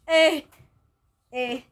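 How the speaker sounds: loud, high "eh" of outrage; shocked outcry. Retold or imitated. Her beanie slips off mid-quarrel, a loud shocked outcry. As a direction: yelp it in sudden shock.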